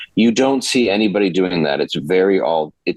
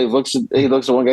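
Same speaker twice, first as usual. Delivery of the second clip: ordinary talk, nothing marked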